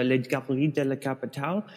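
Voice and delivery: English speaker attempting French; French name in an anglophone accent; foreign accent in French. Real, not acted. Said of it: "I'm trying to speak French".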